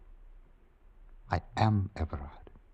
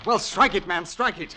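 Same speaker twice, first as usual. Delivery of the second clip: impatient, raised and high; urgent; 10 semitones higher, 5 dB louder than usual. Acted. Master urging his butler to strike the match quickly. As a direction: hurry him along.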